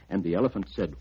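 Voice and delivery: ordinary delivery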